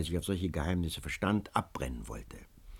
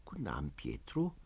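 much softer, low, weary greeting; dejected reply; 6 semitones lower, 10 dB quieter than usual. A narrator's reading. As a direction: answer without any joy. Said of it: "rief Antonio zurück".

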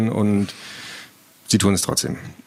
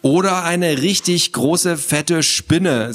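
slow, raised, ominous suggestion; teasing scare; 6 semitones higher, much slower than usual. Acted. Sister scares little brother with a spider, teasing. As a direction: draw it out to frighten him.

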